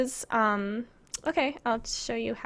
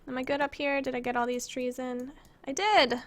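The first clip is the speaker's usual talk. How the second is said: ordinary delivery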